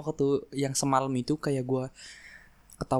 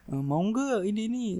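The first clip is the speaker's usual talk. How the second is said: friend's mom; 8 semitones higher than usual. Retold or imitated. Friend's mom offering food.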